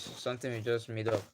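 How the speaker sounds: normal talking voice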